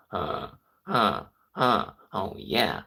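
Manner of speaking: nerdy nasal robot voice. Shown as a demonstration. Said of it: "it sounds like a robot".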